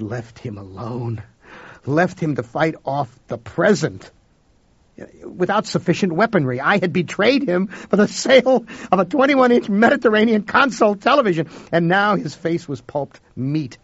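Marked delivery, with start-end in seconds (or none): 1.9-13.8 high, loud, building anguish; rising guilt and anguish. A narrator's reading.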